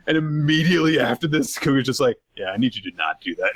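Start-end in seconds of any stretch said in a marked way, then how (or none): 2.4-3.5 deadpan. Acted. Kuga deadpan after silly impression.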